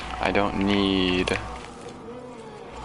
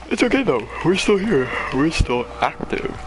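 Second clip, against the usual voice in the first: high chirpy; 9 semitones higher, 3 dB louder than usual. Real, not acted. Reassuring after near loss.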